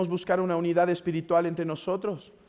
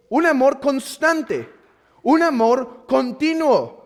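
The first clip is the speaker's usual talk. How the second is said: higher, slow, weighty pulpit delivery; forceful preaching; 7 semitones higher, 6 dB louder, slower than usual. Acted. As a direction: drive each phrase home to the congregation. (predicación enérgica, pausada y solemne)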